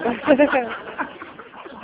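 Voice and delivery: loud high burst of laughter; laughing. Real, not acted. Laughing at own rescue story.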